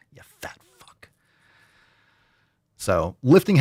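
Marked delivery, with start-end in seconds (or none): 0.1-1.1 breathy whispered jab, no voice; whispered insult. Acted. Host whispering a crude joke insult to listeners. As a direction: hiss the insult under your breath.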